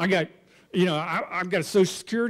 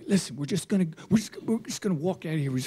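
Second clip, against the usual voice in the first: low hushed; 5 dB quieter, faster than usual. Retold or imitated. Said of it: "And I go".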